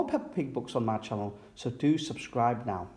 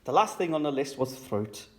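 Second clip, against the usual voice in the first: plain reading voice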